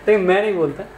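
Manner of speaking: ordinary talk, nothing marked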